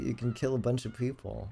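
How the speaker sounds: ordinary talk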